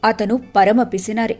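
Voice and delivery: ordinary delivery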